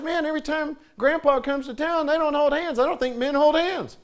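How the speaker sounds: voicing his sons figuring out men don't hold hands; boys realizing. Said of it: "my boys started to realize".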